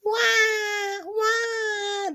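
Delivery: high, hammed-up baby wail; playful fake baby crying; mock baby cry. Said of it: "This is the way a baby cries".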